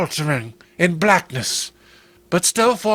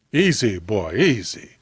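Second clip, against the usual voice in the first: soothing; 7 semitones lower than usual. Acted. A deputy calming Clem before the bushes, soothing. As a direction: settle him down gently, low.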